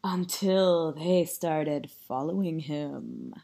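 low, slow, soft storytelling; ominous narration. A narrator's reading. Narrator reveals carrots following Jasper, ominous. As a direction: drop low and slow for suspense.

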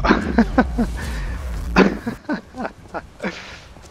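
sudden high burst of laughter; real laugh. Real, not acted. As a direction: laugh out loud, caught off guard. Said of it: "Oh, I'm laughing just 'cause".

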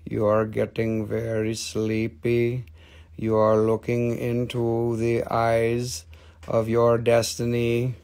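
slow, low hypnotist voice; playful hypnosis patter, slow and low; mock hypnotist. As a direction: lull them like a stage hypnotist. Mock hypnotist on rock sale.

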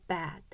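Bad with a wide, long ae vowel; Bad said with a big open vowel. Shown as a demonstration. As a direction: stretch the lips wide on Bad. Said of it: "어떻게 발음해야 되죠".

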